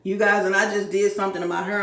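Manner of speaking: normal talking voice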